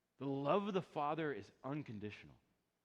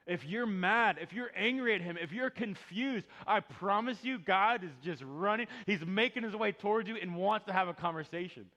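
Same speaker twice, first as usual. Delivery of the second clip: louder, urgent pulpit push; preaching at full force; 6 semitones higher, 7 dB louder than usual. Acted. Pastor urges angry listeners, preaching at full force. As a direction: press it home to the room.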